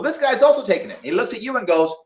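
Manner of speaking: ordinary talk, nothing marked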